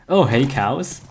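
ordinary talk

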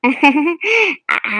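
short giggling laugh; light chuckling giggle; relieved laugh. Acted. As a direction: laugh it off after the scolding. Wife laughs sheepishly at her mistake.

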